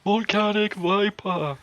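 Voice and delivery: slow, high, flat-delivered move shout; deadpan battle cry. Retold or imitated. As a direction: call out the move name in a deadpan voice. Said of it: "in his very deadpan voice".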